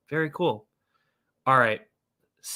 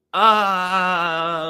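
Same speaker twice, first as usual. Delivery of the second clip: long, loud, high "ahh" of exasperation; frustrated groan; 5 semitones higher, 7 dB louder than usual. Real, not acted. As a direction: groan it out, mad at yourself. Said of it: "I'm so mad".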